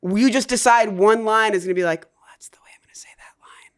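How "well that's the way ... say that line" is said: soft unvoiced whisper. Shown as a demonstration. Actor whispering one chosen audition line. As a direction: drop into a breathy audition whisper. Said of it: "Choose a line to whisper".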